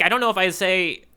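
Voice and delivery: normal talking voice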